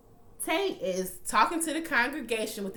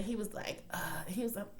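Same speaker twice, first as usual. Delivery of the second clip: whisper; 8 dB quieter than usual. Real, not acted. Narrating a hushed moment.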